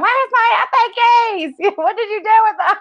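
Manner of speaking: a hammed-up panicked shout; frantic yelling. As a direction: holler through the house for lost keys. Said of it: "screaming across the house".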